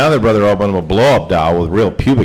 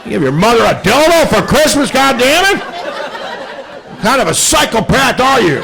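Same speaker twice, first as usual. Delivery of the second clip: dad snapping. Retold or imitated. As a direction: yell it as an enraged father blowing up. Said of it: "And my dad snapped".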